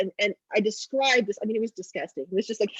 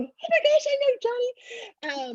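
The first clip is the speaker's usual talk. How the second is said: put-on much higher, drawn-out gushing girl; excited daughter; slower than usual. Daughter Grace recognizing her friend Johnny, excited. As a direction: squeal with recognition. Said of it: "I see Grace going".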